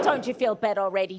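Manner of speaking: ordinary talk, nothing marked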